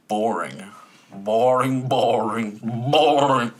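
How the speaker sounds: boring, boring, boring, each one hit a bit harder; repeated for emphasis. Real, not acted. Building emphasis on boring to his co-host. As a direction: hammer the word boring three times, building. Hammering his main complaint.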